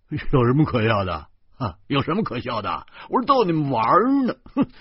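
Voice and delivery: loud, careless brush-off, rising high; brash bluster. A narrator's reading. Driver laughed at by passengers, brash and carefree. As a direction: bluff it off loudly. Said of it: "大大咧咧地说".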